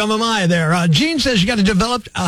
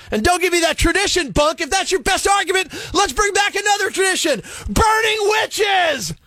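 ranting outburst; 8 semitones higher than usual. Acted. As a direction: rail at them, stretching the final cry. Rant builds to mock battle cry.

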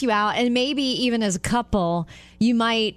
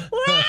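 very high mock baby wail; toddler wail. Toddler bursting into tears, very high mock wail. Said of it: "the little boy went".

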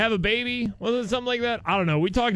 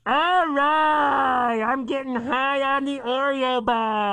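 high, muffled-sounding office lady; office lady stuck in box; 6 semitones higher, slower than usual. Pretend. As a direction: play a trapped, flustered office worker. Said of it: "office ladies walking around the office".

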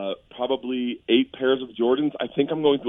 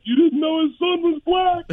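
hysterical Dave; 4 dB louder than usual. Retold or imitated. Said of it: "starts laughing hysterically".

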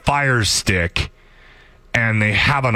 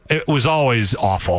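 ordinary talk

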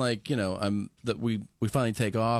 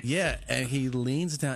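ordinary delivery

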